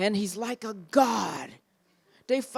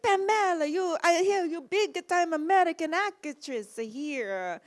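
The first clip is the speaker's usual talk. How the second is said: put-on higher, slow Italian-accented English; 8 semitones higher than usual. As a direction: lean into broken Italian English. Fellini voiced, high.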